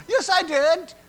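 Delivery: put-on very high, shouted child's protest; kids protesting. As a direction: yell it like an indignant child. Kids protest losing their candy.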